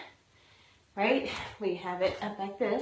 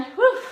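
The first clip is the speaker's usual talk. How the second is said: loud relieved whew; 12 semitones higher, 12 dB louder than usual. Real, not acted. End of leg raises set.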